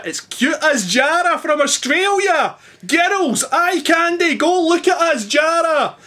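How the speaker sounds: gushing over Jara in a mock Australian voice; Australian accent. Vlogger shows off his not-very-Australian accent to viewers, in a hammed-up Australian accent. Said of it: "Yes, that's my Australian accent".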